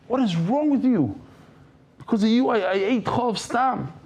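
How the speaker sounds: high, accusing rant; indignant outburst. Retold or imitated. Friend back in the car scolding the driver, high indignant rant.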